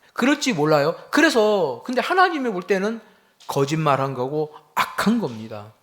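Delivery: loud, high preaching, pressing each phrase; fiery preaching. Acted. Pastor preaching to his congregation, loud and urgent.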